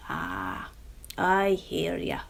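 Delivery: put-on slow, folksy old fellow; a wise old countryman doubting her plan; old Mister Munro. Mister Munro answers Jemima, slow and folksy.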